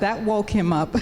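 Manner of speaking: loud, amused interjection through laughter; real laugh around the words; laughing quip. Real, not acted. Wife quips amid laughter.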